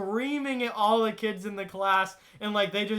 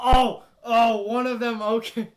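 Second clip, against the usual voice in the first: sudden recollection; 3 semitones higher, 7 dB louder than usual. Real, not acted. Streamer suddenly remembering another class story, loud excited outburst. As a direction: burst in loudly as it hits you.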